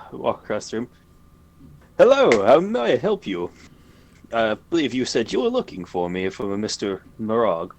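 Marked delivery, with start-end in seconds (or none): 2.0-7.7 innkeeper voice. Acted. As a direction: greet the guests like an eager innkeeper.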